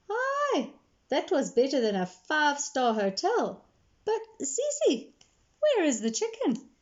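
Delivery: a touch of Gogo's satisfied teasing; grandmother. Acted. Grandmother voiced after meal.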